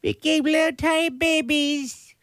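put-on high squeaky baby voice. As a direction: say it like a tiny baby. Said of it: "Why am I talking like that".

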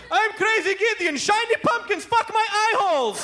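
high-pitched psychotic pitchman parody; hammed-up ad voice coming unhinged; Crazy Gideon. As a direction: rant nonsense like a mad TV salesman. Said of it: "degenerates into a complete nonlinear chain of psychosis".

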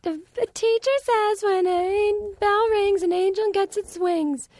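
high, drawn-out imitation of a film line; movie character. Quoting the film's tearjerker ending, high imitated voice. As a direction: put on a slow, very high little voice. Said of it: "when they're like".

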